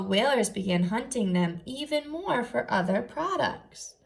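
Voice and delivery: put-on soft, steady read-aloud for young listeners; quiet storybook reading; reading aloud to children. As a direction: read the picture book gently to the class. Teacher reads book aloud.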